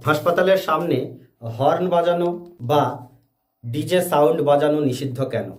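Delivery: ordinary delivery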